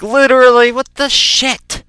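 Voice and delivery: hammed-up appalled reaction; exasperated disbelief. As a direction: stretch it out in exasperation. Exasperated at dad.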